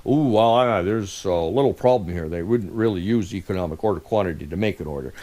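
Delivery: low, grumbling imagined critic; mock objector. Mimicking an objector to the formula.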